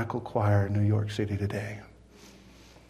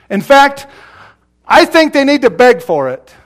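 loud, insistent pulpit delivery; full-force preaching; 7 semitones higher, 12 dB louder than usual. Real, not acted. Preacher warning that asking casually is not enough, full-force preaching. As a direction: drive every phrase home with force.

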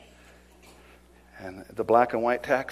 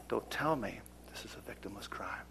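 stern hushed whisper; 4 semitones lower, 10 dB quieter than usual. Retold or imitated. Officer rebuking a prisoner in the car, whispered.

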